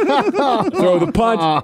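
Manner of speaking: laughing through the words; giggling through the punchline. Real, not acted. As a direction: crack up while telling it. Laughing at his own story.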